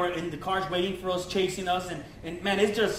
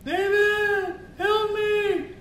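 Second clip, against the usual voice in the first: much higher desperate call; friend crying for help; 11 semitones higher, much slower than usual. Retold or imitated. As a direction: call out from far away for help. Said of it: "I hear my homeboy".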